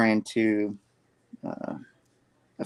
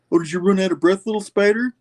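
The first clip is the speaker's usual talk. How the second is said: high, sneering creature voice; mocking monster taunt; 9 semitones higher, 4 dB louder, faster than usual. Venom jeering at the tired Peter, high and sneering. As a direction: taunt him like a gloating beast.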